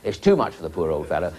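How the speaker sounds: ordinary talk, nothing marked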